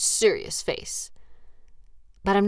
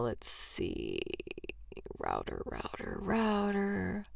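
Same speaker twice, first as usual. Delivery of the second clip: whispered mutter while searching; near-silent searching whisper; muttering to self; 13 dB quieter, slower than usual. Real, not acted. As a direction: mumble it under your breath, looking around. Searching for the router.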